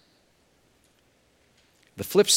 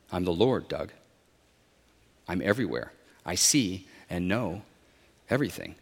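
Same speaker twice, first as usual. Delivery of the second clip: low, soft, unhurried; quiet all-knowing assurance, spaced out; calm voice of God; 7 semitones lower, 7 dB quieter than usual. A narrator's reading. The Lord reveals himself to stranded man, low soft voice.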